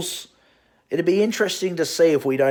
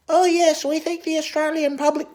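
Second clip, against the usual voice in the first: much higher, sneering put-on politician voice; mock politician voice. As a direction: parody a smarmy politician talking down. Mocking Shorten as a puppet.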